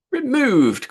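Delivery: sudden high, loud exclamation; Bingley aghast that Jane might be moved; startled cry. A narrator's reading. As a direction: cry out in alarm at the idea. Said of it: "cried Bingley".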